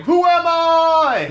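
high, slow, loud anguished cry; dramatic wail. Pretend. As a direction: wail it like a melodrama.

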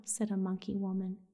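usual speaking voice

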